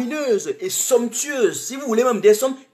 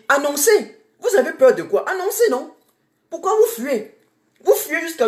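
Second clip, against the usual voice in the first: angry challenge; 7 semitones higher than usual. Real, not acted. Activist challenging fleeing officials, angry high demand. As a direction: throw the challenge at them. Daring officials to leave openly.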